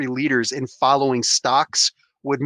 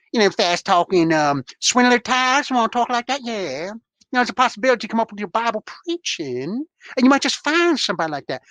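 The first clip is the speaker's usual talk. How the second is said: horrible Southern accent; 7 semitones higher than usual. Shown as a demonstration. Mocking a Southern actor's voice for a co-host, in a put-on Southern accent. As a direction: ham up a fast-talking Southern preacher voice. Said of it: "in my horrible interpretation".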